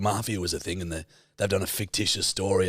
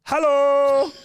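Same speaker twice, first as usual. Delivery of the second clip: high, loud, far-off hello; shouted greeting; 8 dB louder than usual. Acted. Answering a friend's accent prompt with a loud high hello. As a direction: belt out a cartoonish hello.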